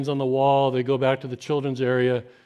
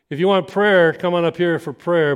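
raised voice inviting the room forward; calling out; 4 semitones higher, 6 dB louder than usual. Retold or imitated. Pastor calling the congregation forward for prayer, raised. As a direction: call them up to the front.

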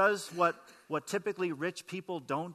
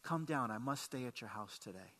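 softer, lower, calm invitation; gentle call; 6 semitones lower, 9 dB quieter than usual. Retold or imitated. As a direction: speak it softly, like calling a friend down. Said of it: "and say".

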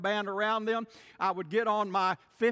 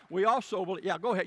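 ordinary talk

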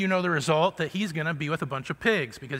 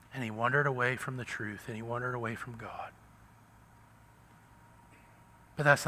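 hushed sermon; 4 dB quieter than usual. Acted. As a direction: pull them in close and quiet. Sermon drops to hushed reflection.

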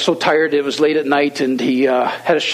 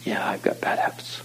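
low, whispery admission; hushed confession; 5 semitones lower, 9 dB quieter than usual. Retold or imitated. Young man admitting bad habits to his host, low and whispered. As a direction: imitate an ashamed young man, barely voiced.

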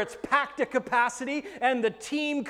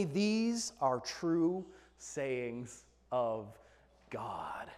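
slow solemn; 8 semitones lower, 7 dB quieter, much slower than usual. Acted.

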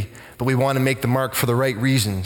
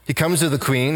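usual speaking voice

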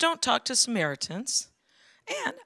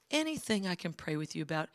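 gentle offer; 2 semitones lower, 8 dB quieter, faster than usual. Retold or imitated. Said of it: "And he said".

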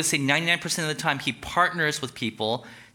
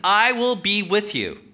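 higher, louder, slow and firm promise; God reassuring a frightened Moses; 7 semitones higher, 5 dB louder than usual. Acted. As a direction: promise it steadily. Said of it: "he says".

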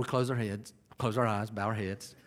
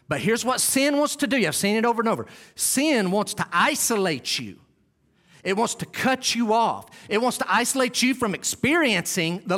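raised, high-pitched urgent sermon; impassioned preaching; 7 semitones higher, 4 dB louder than usual. Real, not acted. Preacher warning the church that sin isolates, impassioned preaching. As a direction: warn the flock with full pulpit force.

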